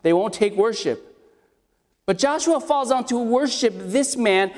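usual speaking voice

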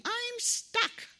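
put-on high child's cry for help; child yelling. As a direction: call out like a stuck little boy. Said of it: "he started yelling".

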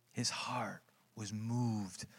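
breathy hushed whisper, slowed. Real, not acted. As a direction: drop to a whisper to draw them in. Hushed line in sermon.

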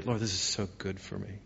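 very soft, private murmur; hushed surrender to God; humble prayer. Acted. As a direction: pray it quietly, accepting the humbling.

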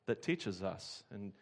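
closing prayer, softening toward the amen. Acted.

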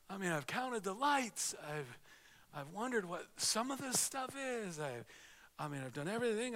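preacher imitating a bored churchgoer in a lightly mocking way. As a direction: play a restless, bored pew-sitter, with a stretched-out plea for home. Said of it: "you're thinking".

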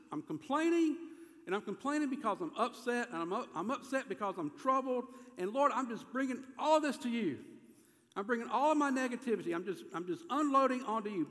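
high, emphatic venting; complaining. Acted. David bringing his troubles to God, complaining. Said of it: "He's complaining".

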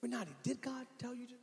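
soft, sly mock whisper; devil whisper. Satan whispering doubt to Renati, soft and sly. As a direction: hush it like the devil sneaking in. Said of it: "he'll try to whisper to you".

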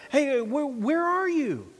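high, drawn-out earnest call. Shown as a demonstration. God looking for hiding Adam, earnest. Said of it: "Was it earnest?".

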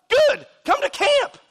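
very high, loud exclamation; high shout. Real, not acted. Preacher urging congregation to camp, high-pitched shout. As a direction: yell the invitation at the crowd. Answers never with loud invitation.

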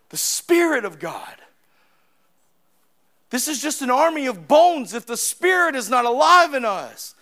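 rising sermon voice with occasional shouts; fervent preaching. Real, not acted. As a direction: press the point home to the church. Preaches again with force.

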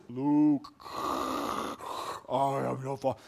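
lightly deepened Vader misquote; a low, quiet hint of Vader; Darth Vader. Preacher repeating the misremembered line, low and quiet. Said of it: "He actually never says".

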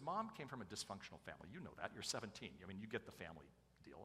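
soft, confiding fatherly explanation; not yelling. Retold or imitated. Father explaining mom's family to daughter, quiet. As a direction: explain it gently to your daughter. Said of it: "I wasn't yelling at her".

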